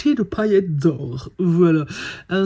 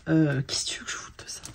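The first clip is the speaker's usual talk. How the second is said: whispered scoff; exasperated whispered aside; 7 dB quieter than usual. Real, not acted. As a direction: scoff under your breath. Dismissive aside about stickers.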